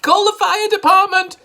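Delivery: high, loud panicked shout. A narrator's reading. Missus Cranky shouting for help, high and loud. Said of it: "shouted Missus Cranky".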